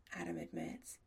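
plain reading voice

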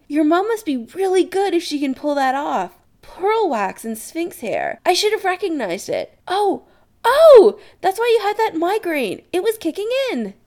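high-pitched excited gush. Acted. Adam working out Chris's potion story, excited. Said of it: "Adam says excitedly".

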